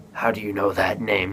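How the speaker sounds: understated imitation of being taken aback; lightly acted stunned question. Batman hearing his mother's name, mildly taken aback. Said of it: "like, taken aback".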